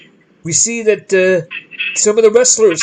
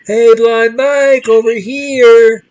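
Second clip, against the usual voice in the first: slow, loud, higher mock call across an arena; crowd heckling call; 5 semitones higher, 5 dB louder, slower than usual. Fans in the crowd calling out loudly to tease Blind Mike. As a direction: call out slowly to mislead him.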